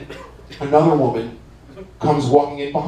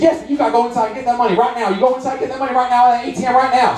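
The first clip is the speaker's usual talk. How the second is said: high, fast mock-irate ordering; bossy demand; 10 semitones higher, faster than usual. Women send the driver for money, high rapid hammed-up demand. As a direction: rattle off orders like an outraged woman.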